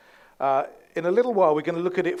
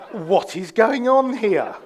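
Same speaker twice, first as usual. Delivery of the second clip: loud, slow, higher-pitched bewilderment; baffled; 5 semitones higher, 8 dB louder, slower than usual. Retold or imitated. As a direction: spell out your disbelief at their behaviour. Baffled reaction to relatives' posh voices.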